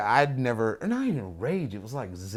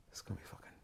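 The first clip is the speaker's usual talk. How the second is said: whisper; 21 dB quieter, much faster than usual. Retold or imitated. Inner thought, dread.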